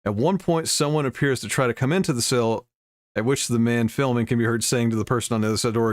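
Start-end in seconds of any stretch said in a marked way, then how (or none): none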